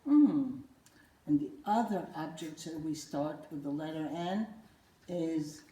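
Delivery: ordinary delivery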